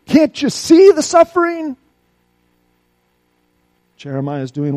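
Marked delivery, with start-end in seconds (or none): 0.1-1.8 loud, high desperate cry to God. Acted. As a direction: shout up to heaven. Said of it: "Don't we cry out to God".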